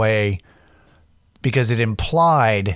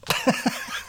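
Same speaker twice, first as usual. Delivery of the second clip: breathy laugh; 7 dB quieter than usual. Real, not acted. Laughing at himself.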